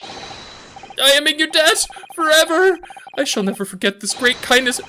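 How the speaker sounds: high, loud tearful-style vow; overwrought gratitude. Acted. Client in his helpers' debt forever, overwrought. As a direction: proclaim your eternal debt melodramatically.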